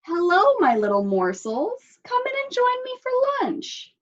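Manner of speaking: high, sugary wolf voice inviting lunch; the hungry wolf welcoming his little morsels sweetly; sly wolf. Acted. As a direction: purr like a wolf eyeing dinner. Wolf greets his prey.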